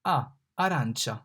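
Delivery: arancia with open ah as in father; Italian A. Shown as a demonstration. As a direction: say arancia with a wide ah. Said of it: "The A here is pronounced like A in father".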